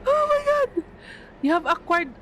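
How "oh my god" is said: high gasp, then shout; gasp and shock. Real, not acted. Shocked by game event.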